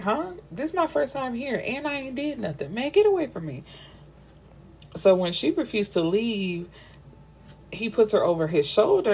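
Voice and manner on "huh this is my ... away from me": higher, fast indignant protest; indignant heroine. Retold or imitated. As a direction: play the wronged girl, huffy and high. Heroine protests being banned.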